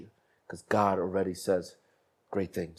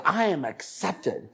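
put-on higher, slow little girl reply with a laugh; 6 semitones higher, slower than usual. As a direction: echo the father like a small girl. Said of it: "He goes".

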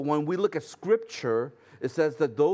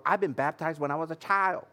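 mock protest from a churchgoer; put-on objector voice; 3 semitones higher than usual. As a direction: play the objector in a silly put-on voice. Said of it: "why I'm talking like that".

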